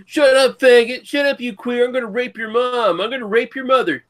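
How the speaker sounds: put-on high, loud screaming kid; screaming kid gamer. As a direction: shriek like an angry kid gamer. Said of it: "then it turns into kids going".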